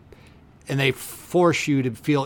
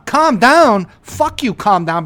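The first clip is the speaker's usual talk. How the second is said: loud, high outraged repeat; indignant echo; 7 semitones higher, 9 dB louder, slower than usual. Retold or imitated. Echoing the guest's calm down in outrage. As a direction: throw it back furious.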